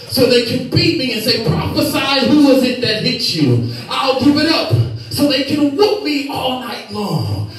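ordinary talk

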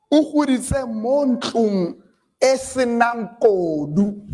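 slow, careful Setswana phrase "O goditse mo ntlong e senang kodu". Shown as a demonstration. Preacher to the congregation, quoting a Setswana saying slowly and carefully. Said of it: "zayifunda ngeSetswana".

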